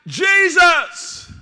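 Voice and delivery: preacher shouting Jesus' name out high and loud; acted shout. Pastor acting out the blind beggar crying to Jesus. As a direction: cry out the name at full voice. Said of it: "the text says Jesus, he shouted".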